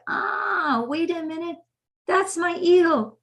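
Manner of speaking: high, drawn-out acted "ah" of recognition; sudden realization. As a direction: catch yourself out, pitched well up. Acted moment of catching the ego.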